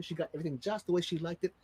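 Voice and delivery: ordinary delivery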